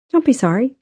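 quick reassurance. A narrator's reading. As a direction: jump in with a lifted pitch. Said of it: "she said quickly".

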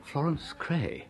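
slow, soft horrified murmur of her name; dismayed. Acted. Bertie recognising his dreaded ex-fiancée, slow appalled murmur. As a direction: let the name drop in quiet dread.

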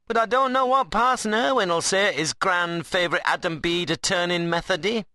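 high, taunting rustic dialect; Ben, cheeky workman. Acted. Ben calls Adam a budding saint, tauntingly. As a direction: play a wiry workman ribbing his mate. Ben's figure voice.

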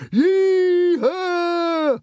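long, very high excited whoop; high drawn-out holler; whooped yee-haw. A narrator's reading. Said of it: "they whooped".

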